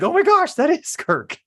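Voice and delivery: high, loud gasp of recognition; astonished mom. Retold or imitated. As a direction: imitate a mom suddenly amazed. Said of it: "she's like".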